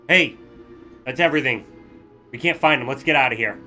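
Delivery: loud call across the room; yelling across. Acted. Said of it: "kind of yelling across you".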